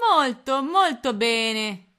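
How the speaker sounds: loud, slow gloating villain voice; horrible voice. A narrator's reading. Captain Codanera pleased with the captured spy, horrible gloating voice. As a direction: relish every word like a cruel captain. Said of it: "disse con voce orribile".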